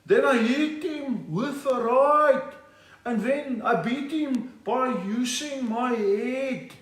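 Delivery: high, slow, drawn-out boxer voice; impression of Gerry Coetzee. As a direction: stretch each phrase out, high and laboured. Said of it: "How did it go, Gary?".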